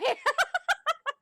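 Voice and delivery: high, loud burst of laughter; real high-pitched cackling laugh. Real, not acted.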